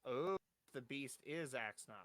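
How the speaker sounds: ordinary talk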